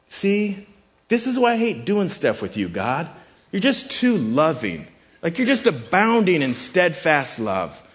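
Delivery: high-pitched, exasperated mock whining; hammed-up petulant protest; ridiculous complaint. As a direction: gripe as if kindness were an outrage. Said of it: "it's supposed to sound ridiculous".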